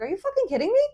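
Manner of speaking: high-pitched retold disbelief; indignant, rising question; incredulous. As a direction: throw it back at him, voice shooting up. Retold incredulous comeback to boyfriend.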